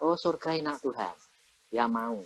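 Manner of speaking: put-on a child's soft murmur about heaven; soft child's prayer. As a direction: hush it down like a small child talking to God. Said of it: "Ngomong gini".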